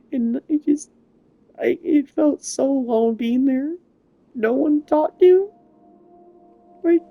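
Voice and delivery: high, strained voice close to crying; holding back tears while telling it; getting emotional. Real, not acted. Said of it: "I'm sorry I'm getting emotional".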